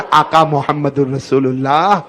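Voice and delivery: normal talking voice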